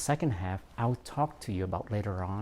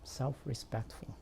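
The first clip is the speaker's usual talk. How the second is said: softly repeated, falling at the end; quiet repeat; 3 semitones higher, 10 dB quieter than usual. Real, not acted. Speaker repeating the topic after a pause, quiet and falling. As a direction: say SELF-RESPECTFUL softly and let it drop.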